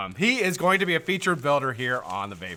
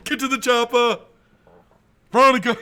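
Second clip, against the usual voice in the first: high, loud mock-heroic yell; hammed-up action call; 12 semitones higher, 10 dB louder than usual. Repeating the getaway order to Veronica, mock shout. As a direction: shout the escape order for laughs.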